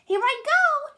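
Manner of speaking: high, loud shout. A narrator's reading. Red Squirrel shouting at the stream's edge, high and loud. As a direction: call out across the stream. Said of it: "he shouted".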